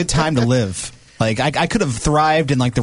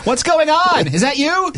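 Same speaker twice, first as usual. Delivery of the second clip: high, naive calling out; clueless movie victim; 11 semitones higher, slower than usual. Pretend. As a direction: play the dumb horror victim.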